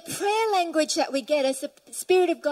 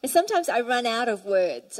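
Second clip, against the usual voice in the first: normal talking voice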